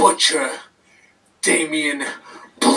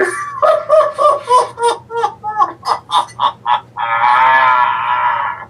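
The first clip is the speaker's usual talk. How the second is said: unhinged sobbing laugh; 6 semitones lower than usual. Acted. Wrestler ends promo.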